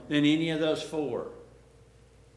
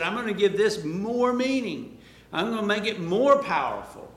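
voicing Jesus; 6 semitones higher, 6 dB louder than usual. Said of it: "and he said".

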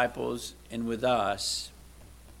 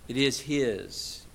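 usual speaking voice

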